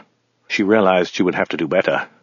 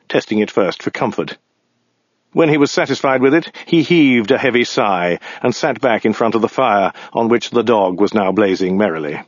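usual speaking voice

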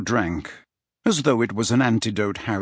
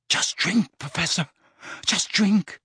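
higher, anxious plea; tense, pitch-raised urging; urgent coaxing; 7 semitones higher than usual. A narrator's reading. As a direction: coax him on, worried but insistent. Harry urges Dumbledore to drink.

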